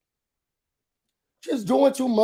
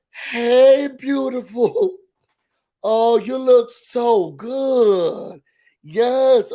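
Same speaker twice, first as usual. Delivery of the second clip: higher, drawn-out friendly gush; gushing greeting; 3 semitones higher, 3 dB louder, much slower than usual. Retold or imitated. Greeting a friend on the phone, gushing. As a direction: lay on the compliments, stretching the words.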